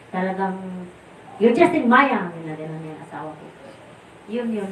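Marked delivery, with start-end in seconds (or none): 1.4-2.3 louder, higher scolding line; rebuke. Retold or imitated. Guru rebuking the husband, louder and higher. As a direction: scold firmly but briefly.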